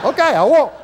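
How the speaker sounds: high, loud sheepish promise; meek promise. Retold or imitated. Grandfather promises the scolded grandson, high and loud. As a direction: answer the little one like a scolded kid.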